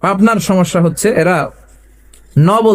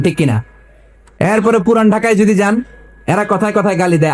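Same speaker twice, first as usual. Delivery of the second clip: ordinary talk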